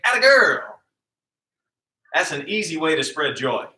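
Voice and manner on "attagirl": high, loud bright praise call; cheery praise. Shown as a demonstration. Coach shows kids how to praise a girl, loud and high. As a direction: cheer a teammate on, pitched up. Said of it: "look at 'em and say".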